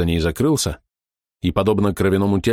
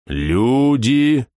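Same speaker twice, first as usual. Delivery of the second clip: booming voice; 4 semitones higher than usual. Acted. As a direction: boom it out like a giant. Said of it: "вновь громыхнул голос".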